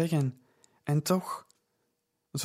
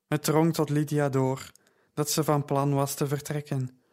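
ordinary delivery, nothing marked

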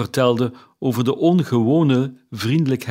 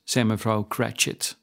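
usual speaking voice

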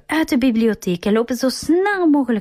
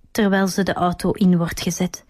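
ordinary delivery, nothing marked

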